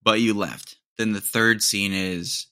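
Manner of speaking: ordinary delivery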